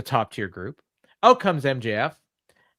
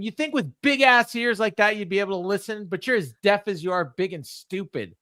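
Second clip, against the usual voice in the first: higher, sneering mock champion; mock him with a smug sneer; lightly voiced heel insult; 7 semitones higher than usual. Mimicking MJF insulting Brody, mildly sneering. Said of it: "he says to him".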